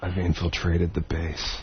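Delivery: hushed, slow, low secret-agent voice; super spy. Pretend. Comedian role-playing for phone sex, hushed super spy. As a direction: report in secret, low and very soft. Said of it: "sometimes I pretend I'm a super spy".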